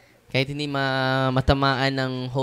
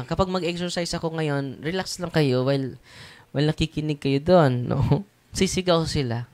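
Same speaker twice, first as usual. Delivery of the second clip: ordinary talk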